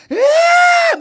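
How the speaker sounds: very high, loud mock wail; piercing imitation sob; siren-like crying. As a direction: imitate someone bawling like a siren. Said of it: "우는 소리가".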